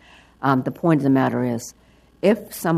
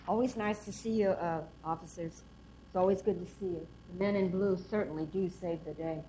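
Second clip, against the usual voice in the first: a real frog in the throat, words rough and catching; froggy voice; 5 semitones higher, 14 dB quieter than usual. Real, not acted. Testifying to the council with a real froggy throat. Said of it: "but I'm a little froggy".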